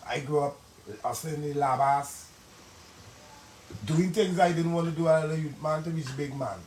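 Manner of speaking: ordinary talk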